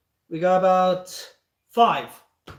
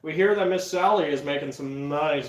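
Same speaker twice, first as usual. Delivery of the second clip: fifties news anchor. Acted. Greeting ladies and gentlemen, fifties news anchor voice. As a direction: announce it like a fifties newscaster. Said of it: "one of those nineteen fifties, um, news anchors".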